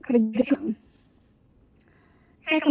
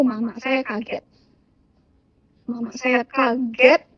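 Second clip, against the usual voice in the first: ordinary talk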